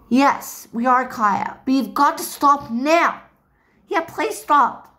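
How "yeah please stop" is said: ordinary talk, nothing marked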